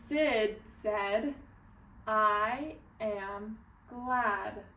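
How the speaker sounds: slow, separate words with gaps; word-by-word read-along pace; slow careful reading. Shown as a demonstration. Teacher tries the line again with children, slow and deliberate. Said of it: "Let's try it again".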